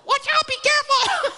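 very high squeaky cartoon voice; brain's voice. Preacher's brain warning him about the husband, squeaky panic. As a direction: play a panicky inner voice in falsetto. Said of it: "in, in, in this voice".

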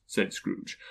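plain reading voice

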